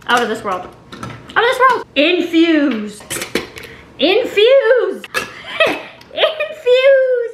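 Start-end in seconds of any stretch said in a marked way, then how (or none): 0.9-1.9 much higher, playful OUT OF THIS WORLD; playful high boast. Pretend. Vlogger boasting about her own intellect, playful high boast. Boast repeated in mock grand voice. 1.9-7.3 drawn-out call, climbing much higher; playful incantation. Pretend. Vlogger telling her fruit jars to infuse, building playful call.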